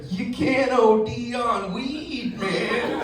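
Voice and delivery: stoner drawl. Retold or imitated. Stoner friend's slow reassurance.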